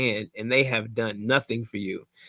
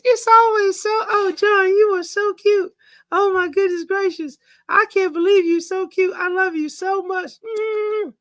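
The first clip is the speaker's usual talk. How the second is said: much higher, gushing, doting wife voice; Joy voice; 4 dB louder than usual. Retold or imitated. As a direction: coo over your husband, pitched way up. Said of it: "in my best Joy voice".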